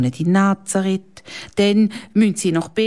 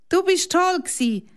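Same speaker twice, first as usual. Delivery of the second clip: put-on bright, much higher child voice; high girl's praise; 12 semitones higher than usual. Annika praises Laura after the play, high girl voice. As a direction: praise her like an excited girl. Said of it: "sagt Annika zu der Laura".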